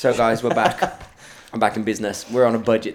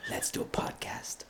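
whispering; 11 dB quieter than usual. Retold or imitated. Retelling a secretive invitation.

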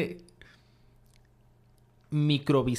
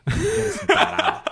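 squealing through laughter; laughing; 7 dB louder, slower than usual. Real, not acted. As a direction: laugh it out high. Laughing insult at friend.

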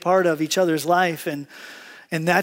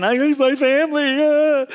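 high, loud hammed-up wail; mock crying; 9 semitones higher, 4 dB louder than usual. Preacher mimics contestant crying three days in, mock sobbing. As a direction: whine it like a badly homesick contestant. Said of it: "they're crying".

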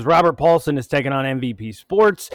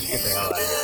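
high squeaky voice; 7 dB quieter, much faster than usual. Acted. Mock rejecting organic bacon.

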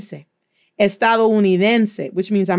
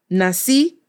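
Nací with the final í leaned on and rising; na-SÍ, second syllable held; stress on last syllable; 2 semitones lower than usual. Shown as a demonstration. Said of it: "but you just stress it".

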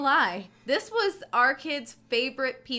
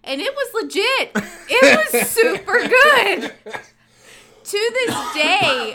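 high, loud and slow gushing; excited; 8 semitones higher, 6 dB louder, slower than usual. Real, not acted. Co-host remembering her kids' favorite pizza, excited. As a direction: rave about that pizza.

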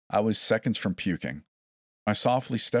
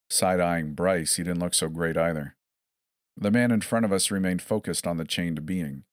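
ordinary delivery, nothing marked

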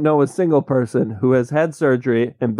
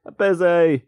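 mimicking a British brush-off, higher pitched; British cousin accent; 5 semitones higher than usual. Imitating the busy wizard's brush-off, British cousin accent. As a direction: put on a mock British accent. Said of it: "Sounds like a British cousin".

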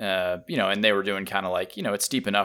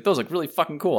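higher, excited admiration; impressed hiker; 8 semitones higher, 4 dB louder than usual. Retold or imitated. Hiker to the rider, a higher, impressed gush. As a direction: gush about the motorcycle. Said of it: "He's like".

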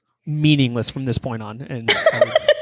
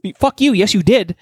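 high, heated snap back; arguing with his wife, angry retort; 5 semitones higher than usual. Retold or imitated. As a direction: throw the accusation back at her. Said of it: "I'm like".

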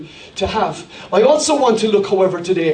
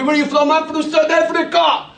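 a visitor answering in a lightly put-on South African accent; 5 semitones higher than usual. A guest saying where he's from in a South African accent. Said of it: "somebody comes from South Africa".

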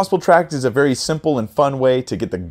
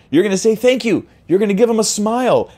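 cheerful lift; 10 semitones higher, 3 dB louder than usual. Acted. Cheerful instruction.

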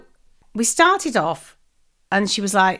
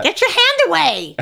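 higher-pitched telling-off, imitated; mock scolding; 5 semitones higher than usual. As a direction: snap it like an outraged server. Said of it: "And she went".

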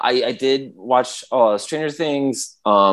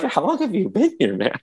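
1 amused disbelief; 9 semitones higher, 3 dB quieter than usual. Retold or imitated. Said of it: "And I'm like".

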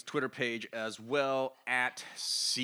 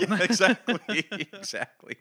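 laughing; 9 semitones higher, 9 dB louder than usual. Real, not acted. Laughing at co-host's joke.

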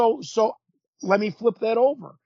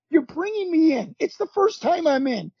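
pitched-up, insistent self-defence; indignant protest; 6 semitones higher than usual. Retold or imitated. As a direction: re-enact arguing your case to the veterans. Retold protest to older wrestlers.

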